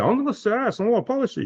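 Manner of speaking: high, put-on island accent, foreign trustee refusing; a hammed-up, half-Swiss island accent for the polite refusal; terrible foreign accent. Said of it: "that was terrible, uh, accent".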